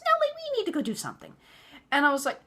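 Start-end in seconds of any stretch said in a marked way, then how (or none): 0.0-1.3 squeaky high, fast insistent mock voice; high nagging mother-in-law. Mother-in-law insisting on an outing, mocked high and fast. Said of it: "I'm like".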